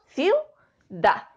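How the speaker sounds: "ciudad" split as ciu, pause, dad; "ciudad" in two detached beats; syllable by syllable. Shown as a demonstration. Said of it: "Separamos en sílabas".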